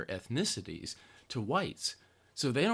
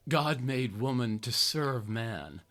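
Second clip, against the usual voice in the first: ordinary talk, nothing marked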